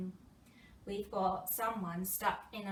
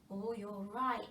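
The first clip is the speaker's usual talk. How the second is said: ordinary delivery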